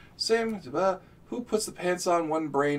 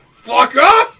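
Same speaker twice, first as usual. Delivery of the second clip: loud laughing shout; 11 semitones higher, 17 dB louder than usual. Acted. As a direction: yell it while laughing. Mock round-table quarrel.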